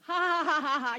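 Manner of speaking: loud, high fake laugh in disbelief; hammed-up scoffing ha-ha-ha; mock laughter. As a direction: laugh it off like a joke. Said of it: "You would be like".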